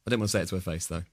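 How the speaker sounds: ordinary talk, nothing marked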